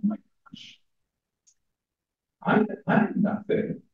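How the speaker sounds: hushed horrified self-reproach; anguished whisper. Acted. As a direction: breathe it out as if crushed by guilt. Character despairs over his flaws.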